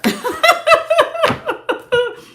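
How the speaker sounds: a sudden squealing laugh; high laugh. Real, not acted. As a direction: burst of high-pitched laughter. Bursts out at querent's despair.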